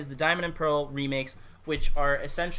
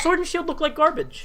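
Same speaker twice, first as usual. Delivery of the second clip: heated outburst; 12 semitones higher, 5 dB louder than usual. Real, not acted. Heated rant about the game.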